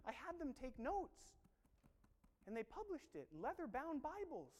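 acted incredulous rebuke; exasperated Jesus. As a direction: scold them like an ignored teacher. Acting Jesus answering passive prayers.

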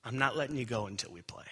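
soft, low, coaxing dad; a quiet loving father holding his son on his lap; loving firmness. Retold or imitated. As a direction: gently insist he stay and play. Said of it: "You can do that lovingly".

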